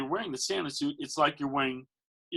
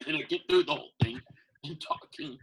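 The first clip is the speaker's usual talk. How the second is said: ordinary delivery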